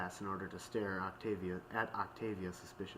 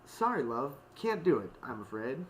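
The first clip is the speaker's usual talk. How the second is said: higher British barmaid voice; rough British accent, pitched up at first; 5 semitones higher, 4 dB louder than usual. A narrator's reading. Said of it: "British accent assaulted Octavia's eardrums".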